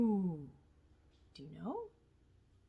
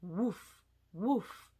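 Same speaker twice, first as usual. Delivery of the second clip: dog bark. Acted. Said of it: "This animal says".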